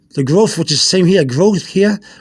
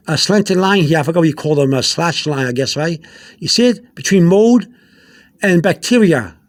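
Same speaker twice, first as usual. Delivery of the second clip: ordinary delivery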